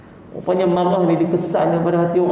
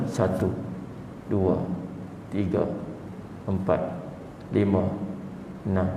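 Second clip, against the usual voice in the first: a calming count under the breath; slow quiet count; 8 semitones lower, 10 dB quieter than usual. Shown as a demonstration. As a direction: count to six softly, slowly. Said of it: "Enam second". (mengira perlahan dan lembut sampai enam)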